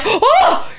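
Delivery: very high imitated gasp; shocked gasp. Lady hears the news, imitated shocked gasp. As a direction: mimic a sudden horrified intake. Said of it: "and she gasps".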